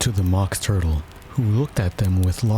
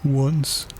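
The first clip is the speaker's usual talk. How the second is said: long sigh, then a higher, mournful word; doleful sighing turtle; deep sigh; 6 semitones higher than usual. A narrator's reading. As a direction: sigh heavily before speaking. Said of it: "with a deep sigh".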